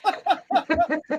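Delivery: high rippling laugh; real laugh. Real, not acted. Host reading a comment about hilarious jokes, laughing. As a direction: laugh freely, pitch up.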